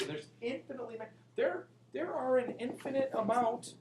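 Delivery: usual speaking voice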